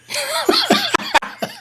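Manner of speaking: a sudden high burst of laughter. Real, not acted. Co-host laughing at the driver's polite apology, high burst of laughter. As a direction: crack up high and bright.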